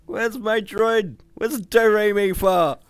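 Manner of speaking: high, loud, drawn-out wail; consternation. A narrator's reading. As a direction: cry out in panic for a lost companion. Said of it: "glancing around in consternation".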